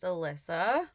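usual speaking voice

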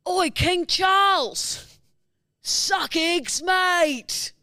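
high, drawn-out British heckle at full volume; working-class British yell. Acted. Unhappy Brit heckling King Charles at the coronation, loud British yell. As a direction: yell it slowly like a rowdy British protester. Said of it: "you're a working class Brit".

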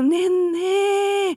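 drawn-out, high, feeble mock wail; long, high, pitiful mock call; retold cry for help. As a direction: imitate a weak wail for a friend. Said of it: "venninna mi, og banke".

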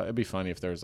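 ordinary delivery

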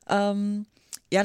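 ordinary talk

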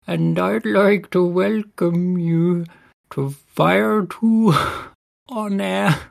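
put-on high, slow bleating goat with Welsh accent. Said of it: "a Welsh goat is gonna be".

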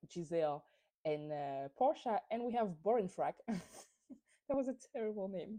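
ordinary delivery

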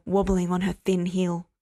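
plain reading voice

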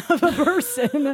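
laughing. Real, not acted.

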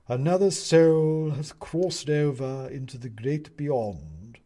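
slow, loud owl judge, drawn out; solemn. A narrator's reading. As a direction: pronounce it gravely from the bench.

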